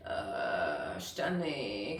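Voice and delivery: very low, soft creaky drone; fed up, creaking low; vocal fry. Shown as a demonstration. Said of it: "donc je suis en fry". (voix en fry, très grave et lasse)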